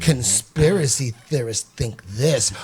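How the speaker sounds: slow, mock-patronizing narration; condescending narrator. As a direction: draw it out like a condescending documentary narrator. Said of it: "the condescending narrator, the woman".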